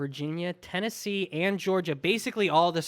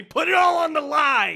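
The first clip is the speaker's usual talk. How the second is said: higher, louder mock-hype call; hyped-up shout; 10 semitones higher, 6 dB louder than usual. Host hyping his friend's stretch, hammed-up shout.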